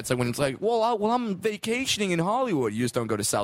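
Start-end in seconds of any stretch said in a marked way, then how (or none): 0.6-2.7 high-pitched mock tourist voice; naive tourist. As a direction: play a clueless holidaymaker.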